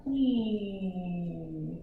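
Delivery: soft, low "nee" as the planet slows; a quiet dragging zoom. Shown as a demonstration. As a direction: let the zoom sound drag. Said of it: "the same time".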